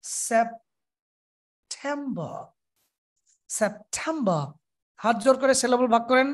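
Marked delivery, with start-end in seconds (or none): 0.0-4.4 September slow with টেম leaned on; stress on টেম. Shown as a demonstration. Teacher models stressed টেম in September. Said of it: "টেমের উপর জোর হবে".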